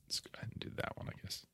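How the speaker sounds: fast, almost silent whisper. Real, not acted. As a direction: mutter it under your breath. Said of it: "that's a little too moody".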